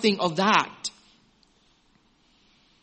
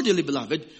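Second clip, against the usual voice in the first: ordinary talk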